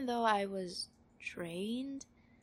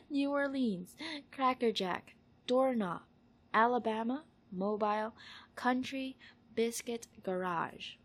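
distinct words, a little lifted and louder; word-list reading; 3 semitones higher, 6 dB louder than usual. Shown as a demonstration. Saying these words for an accent survey, careful list reading. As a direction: announce each word on its own.

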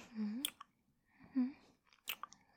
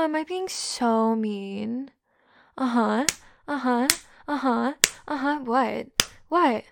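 louder, feigned innocent teasing; mocking taunt; 8 dB louder than usual. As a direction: ham up the fake concern.

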